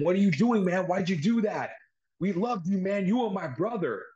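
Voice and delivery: hurt brother yelling in disbelief; retold yelling. Jey yelling at Zayn for betraying them, mock yell. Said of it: "He starts screaming at him".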